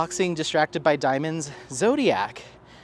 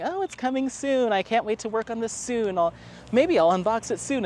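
mock chirpy promise; 6 semitones higher than usual. Mocking her own promises.

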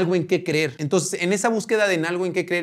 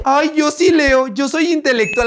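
mock a smug pretend intellectual; snooty know-it-all; 9 semitones higher, 5 dB louder than usual. Vlogger mimicking a smug commenter who thinks she's smart. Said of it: "Porque se creen".

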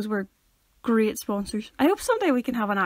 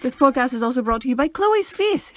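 louder, teasing announcer delivery; mock sponsor read; 3 semitones higher, 6 dB louder than usual. Host teasing co-host about the face, mock ad read. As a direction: present the joke sponsor grandly.

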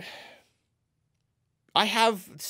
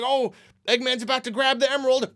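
higher, fast and urgent; urgent viewer; 6 semitones higher, 3 dB louder, faster than usual. Retold or imitated. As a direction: warn the hero in a rush. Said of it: "I'm just yelling at the screen".

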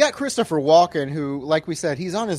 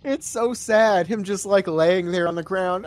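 normal talking voice

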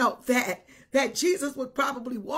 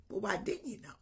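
hushed retort; 6 semitones lower, 11 dB quieter, faster than usual. Retold or imitated. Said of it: "I say".

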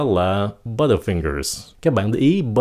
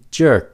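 "jerk" punched high and loud; stressed jerk; 5 semitones higher, 5 dB louder than usual. Shown as a demonstration. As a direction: hit "jerk" hard, higher pitched. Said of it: "Các bạn nhớ nhấn âm nó".

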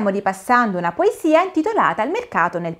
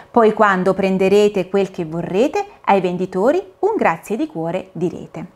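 sing-song, lively poem reading, higher; poem recitation; 4 semitones higher than usual. Acted. Dorina and caterpillars recite the poem, sing-song. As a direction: recite it like a nursery rhyme.

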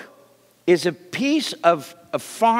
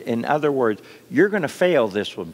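ordinary delivery

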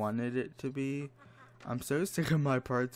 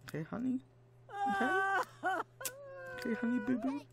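mock sweet voice; much faster than usual. Mock-sweet threat to Sim.

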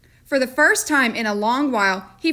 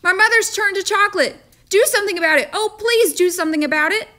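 much higher, louder pleading; frantic pleading boy; 9 semitones higher, 4 dB louder, faster than usual. A narrator's reading. Boy frantically begging the storekeeper, much higher and louder. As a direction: beg desperately like a scared child.

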